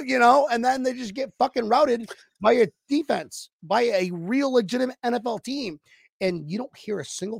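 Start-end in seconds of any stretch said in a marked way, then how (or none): none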